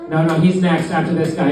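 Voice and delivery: ordinary talk, nothing marked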